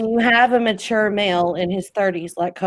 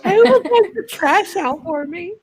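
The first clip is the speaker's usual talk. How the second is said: very high, helpless Southern belle; 10 semitones higher than usual. Pretend. Host fretting over chores without a man, mock-helpless Southern belle. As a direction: wail it as a hammed-up Southern damsel. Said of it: "do it in my best Southern voice".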